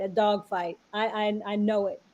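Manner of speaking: ordinary delivery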